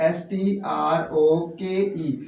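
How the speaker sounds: letters dictated slowly; teacher spelling for students. Shown as a demonstration. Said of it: "stroke क्या होता है".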